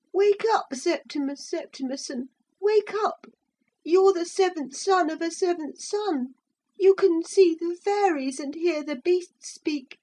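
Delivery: put-on high child voice calling wake up; a child mimicking the wind's call; high boy voice quoting the wind; boy voicing the wind. A narrator's reading.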